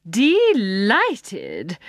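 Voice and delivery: lion accepts Jane's request; mincing voice. A narrator's reading. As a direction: purr it out, prim and pleased. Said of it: "in rather a mincing voice".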